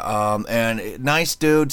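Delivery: ordinary delivery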